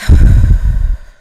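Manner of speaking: long breathy release sigh; loud exhale sigh. Real, not acted. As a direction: blow the breath out loudly.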